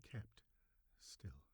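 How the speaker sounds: breathy whisper, almost no voice. A narrator's reading. Narrator frozen beside the old man, whispered. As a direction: hush the word as if frozen in the dark. Narrator keeps deathly still.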